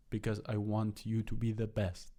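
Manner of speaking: ordinary talk, nothing marked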